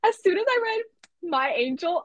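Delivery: high, loud emotional cry of joy; emotional outcry. Real, not acted. Reading the birthday text, crying out loud. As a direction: overcome with feeling, calling out.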